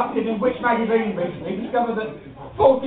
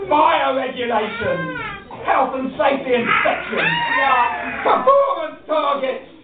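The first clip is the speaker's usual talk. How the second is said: building rant; 8 semitones higher, slower than usual. Acted. Rant list building to a shout.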